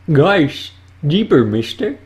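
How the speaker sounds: high, loud goofy cartoon voice; excited cartoon dog voice. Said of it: "That was Goofy saying a line".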